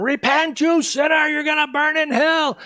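high, loud mock street-preacher shout; imitated bullhorn screaming. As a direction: bellow damnation like a man with a bullhorn. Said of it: "he starts screaming in this bullhorn".

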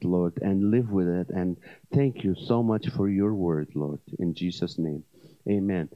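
low, hushed, reverent prayer. Acted. Pastor prays aloud.

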